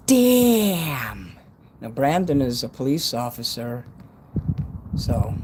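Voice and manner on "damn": surprised shout. Real, not acted. Reacting loudly to a viewer.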